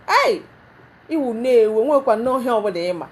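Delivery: loud, mocking husband brushing her off; scornful husband. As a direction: scoff at her loudly. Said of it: "Just glares at you and say".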